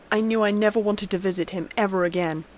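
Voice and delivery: ordinary delivery, nothing marked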